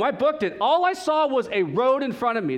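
usual speaking voice

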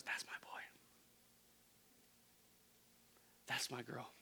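soft, breathy loving whisper; hushed fatherly tenderness; tender whisper. Retold or imitated. God's tender words, whispered.

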